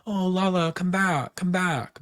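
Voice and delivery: high, whiny mock plea; mimicking Tom calling after Lala in a high whine; mocking Tom pleading. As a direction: lampoon him begging her to come back. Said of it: "he does this thing where he's like".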